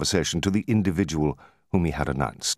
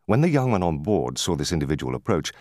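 usual speaking voice

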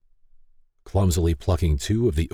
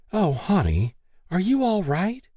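much higher anxious voice; worried mother; 14 semitones higher than usual. Acted. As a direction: ask anxiously like a mom.

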